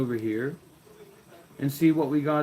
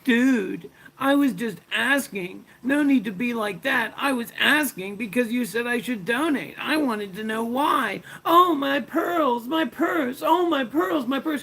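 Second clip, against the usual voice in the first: high, whiny mock voice climbing even higher and slower into a pearl-clutching wail; mocking whiny Anton; 9 semitones higher than usual. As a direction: sneer at him by playing a prissy whiner, building to a clutched-pearls shriek. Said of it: "This fucking Anton from Denmark, what a loser".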